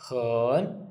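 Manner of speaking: "Hen" going down, then up again; Mandarin third tone. Shown as a demonstration. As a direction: Mandarin hěn, uh-like vowel, dipping-rising. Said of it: "then we go up again".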